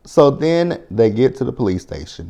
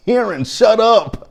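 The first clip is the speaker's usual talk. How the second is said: higher, slow, fed-up "shut up"; exasperated; 12 semitones higher, 4 dB louder, slower than usual. Retold or imitated. Telling Karen in the video to stop talking, exasperated. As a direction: drag it out like you've had enough.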